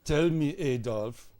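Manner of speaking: measured, questioning mock German accent. Warm-up leader questioning Adolf, German accent. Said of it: "I'm gonna do German".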